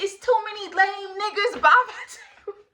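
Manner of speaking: high, loud yell breaking into a laugh. Retold or imitated. Yelling a complaint to her friend, laughing. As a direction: holler it over the noise, laughing. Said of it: "I'm really yelling this to her".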